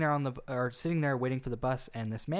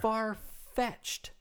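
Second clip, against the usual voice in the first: much higher, incredulous pitch; retold disbelief; 10 semitones higher than usual. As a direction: land each word in disbelief. Teller's retold disbelief at story.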